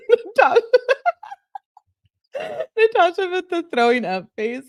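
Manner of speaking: high-pitched, loud laughing words; cracking up. Real, not acted. Streamer reacting to a viewer's throwing-up face, cracking up. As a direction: squeal it out through laughter.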